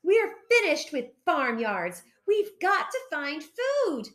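high-pitched exasperated complaint; crying out. A narrator's reading. Hungry foxes giving up on farmyards, crying out. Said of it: "they cried".

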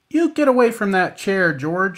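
loud, high scolding nurse; sharp loud reprimand, pitched high. Acted. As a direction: scold him off the chair. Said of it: "she scolded".

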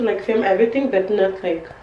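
usual speaking voice

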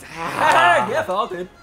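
slow, drawn-out gloating taunt; mock announcer taunt. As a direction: ham it up like a game voice line. Repeating game voice line.

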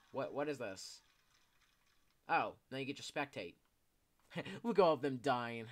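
ordinary talk

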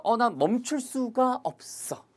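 high, slow mock despair; acting helpless. Teacher voicing a helpless learner, high and slow. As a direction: act out being unable to stop.

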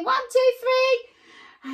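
high, loud, drawn-out count; slow, sing-out shouted count. Shown as a demonstration. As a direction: belt out the count to wake them up. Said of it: "No, not loud enough".

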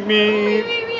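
mock deep voice. Kids playing, child mimics loudly.

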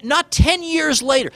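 "ten" hit high and slow; stress on ten. Real, not acted. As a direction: lean hard on "ten", slowing down. Emphatic rhetorical point.

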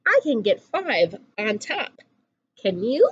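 usual speaking voice

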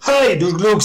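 loud high greeting call; exclaimed greeting. A narrator's reading. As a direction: call out a cheery hail. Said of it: "s'exclama-t-il en guise de salut".

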